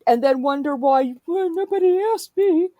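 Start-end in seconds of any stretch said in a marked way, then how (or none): 1.2-2.7 high, sulky mock whine. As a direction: imitate a sulky whiner, pitched up. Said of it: "I'm tired of that bullshit whining".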